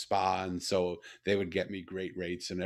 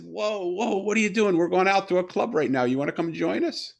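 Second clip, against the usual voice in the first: excited invite; 9 semitones higher, 9 dB louder than usual. Retold or imitated. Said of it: "And he said".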